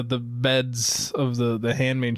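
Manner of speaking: ordinary talk, nothing marked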